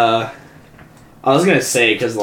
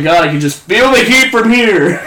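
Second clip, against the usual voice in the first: mocking jab; 9 semitones higher, 5 dB louder than usual. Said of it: "And he's like".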